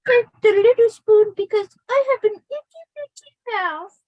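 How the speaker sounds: high, squeaky itty bitty voice; teasing grandpa. Retold or imitated. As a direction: tease her in a tiny high voice. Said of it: "he would go".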